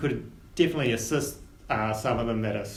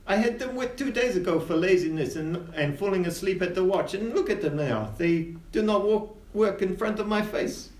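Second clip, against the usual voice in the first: raised, exasperated captain; stern captain voice; 7 semitones higher than usual. Acted. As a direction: complain like a fed-up captain. Captain Winter complains about crew.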